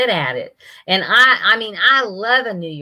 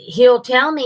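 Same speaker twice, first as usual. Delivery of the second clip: ordinary talk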